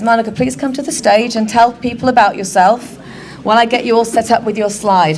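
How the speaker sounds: ordinary talk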